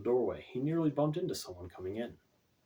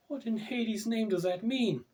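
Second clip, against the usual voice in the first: put-on high, slow indignant young woman; demanding; 12 semitones higher, 3 dB louder, slower than usual. A narrator's reading. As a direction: demand it, voice pitched way up in disbelief. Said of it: "Stacy demanded".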